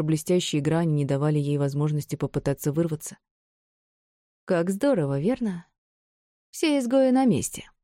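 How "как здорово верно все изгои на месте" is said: mocking smirk in the voice. A narrator's reading. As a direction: say it with a scornful smirk. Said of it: "хмыкнула Брон".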